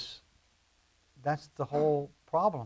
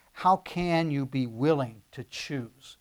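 slow and deliberate; slow paraphrase; much slower than usual. Acted. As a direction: lean on every word. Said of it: "Jesus is saying".

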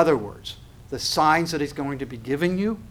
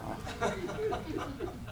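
breathy laugh; 12 dB quieter than usual. Real, not acted. Preacher laughs at own joke.

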